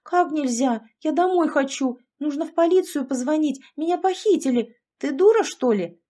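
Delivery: high-pitched indignant outburst; frantic protest. A narrator's reading. Alexandra learns she cannot go home, crying out hysterically. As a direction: protest it in rising panic.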